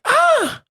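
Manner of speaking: short, very high "ah"; imitated vocal gasp. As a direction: imitate the singer's high cry. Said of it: "She's like".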